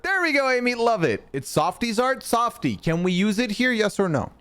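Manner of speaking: high-pitched, delighted cheer; real delight. Real, not acted. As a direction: celebrate a small win, voice lifted high. Delighted that the emote worked.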